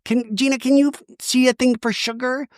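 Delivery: high, put-on dodging voice; hammed-up evasive Shannon; Shannon impression. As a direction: brush off the question in a mock voice. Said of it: "And Tamara's like".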